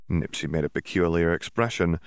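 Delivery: plain reading voice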